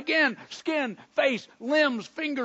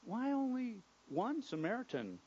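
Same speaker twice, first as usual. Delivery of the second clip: soft, low, puzzled disappointment; gentle, hushed questioning with mild disappointment; disappointed perplexity; 6 semitones lower, 12 dB quieter than usual. Acted. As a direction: ask it quietly, a little let down. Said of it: "with a little disappointment, a little perplexity in his voice".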